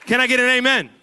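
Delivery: full-throated, high call for amen; rousing preacher shout. Real, not acted. Preacher asking the congregation again for amen, rousing shout. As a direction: belt it out to wake the crowd. Repeats it louder for response.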